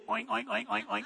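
soft imitated pig oinks; quick little run of pig grunts; pig oinking. Pig goes by on the sheet, soft oinking.

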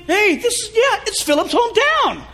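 high, eager teasing disciples; mock disciples. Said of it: "And they all think".

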